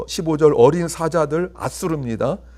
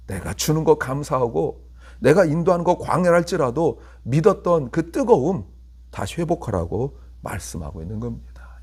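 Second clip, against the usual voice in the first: ordinary talk, nothing marked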